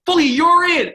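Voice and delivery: high, loud bellow down the bench; coach yelling. Retold or imitated. Coach hollering at his goalie from the end of the bench, yelling. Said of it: "Jimmy Halton's yelling".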